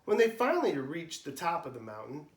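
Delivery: usual speaking voice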